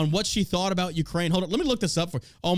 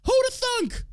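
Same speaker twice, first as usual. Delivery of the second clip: high, airheaded mock voice, much higher; mock clueless bride; 8 semitones higher than usual. Podcaster mocking the ISIS bride, high ditzy mock voice. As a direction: play her as a ditzy naive woman, pitch way up.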